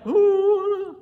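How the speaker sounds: high, loud spooked ooh; mock fearful ooh. Preacher mocking a scared congregant's spooky ooh. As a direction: act out a fake shiver of fear. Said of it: "Should I be like".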